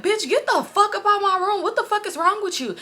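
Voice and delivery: high-pitched indignant scolding; imitated angry outburst. Man ordering girl out of his room, imitated outrage.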